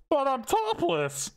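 high, slow, muffled protest through a door. Retold or imitated. Brother answering through the door, muffled and high. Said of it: "all I hear is a muffled".

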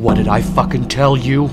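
loud, angry superhero confrontation; intense intimidation. Acted. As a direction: confront him loudly to terrify him. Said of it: "really fucking intense with the guy".

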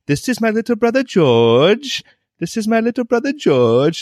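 put-on high, sing-song British kid voice; gleefully parroting a British cartoon child; mock-British catchphrase. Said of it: "saying little things in British".

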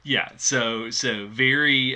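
ordinary talk, nothing marked

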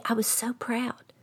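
soft proud whisper to the listener. Retold or imitated. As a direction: breathe it out like a secret boast. Proud of a perfect order.

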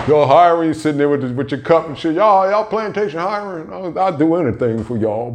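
high eager mock plea, pushed up; begging voice. Mocking himself begging the plantation for work, high and eager. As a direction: play a desperate job seeker begging.

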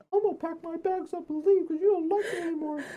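high, whiny sobbing imitation of her; mock crying girlfriend. Boyfriend mocking his girlfriend's tearful exit, high mock sob. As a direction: mimic her tearful wail, hammed-up.

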